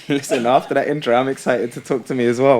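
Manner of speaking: loud, laughing burst of energy; laughing excitement. Real, not acted. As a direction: blurt it out, buzzing and laughing. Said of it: "Oh, energy".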